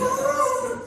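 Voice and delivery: high-pitched mock cry of pain; high burning-tongue cry. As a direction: let out a high "ah" as if your tongue burns.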